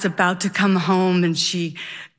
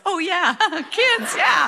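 laughing afterthought; 9 semitones higher than usual. Retold or imitated. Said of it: "And my dad yells".

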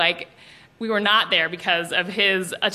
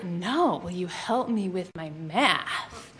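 lower, softer, slow insinuating ask; low suggestive ask; 4 semitones lower, 7 dB quieter, slower than usual. Retold or imitated. Boy repeating the line to oblivious classmate, low and slow. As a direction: lean on the innuendo, slow and low. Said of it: "he's like".

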